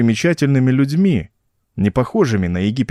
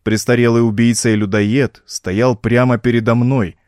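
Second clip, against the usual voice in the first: ordinary delivery, nothing marked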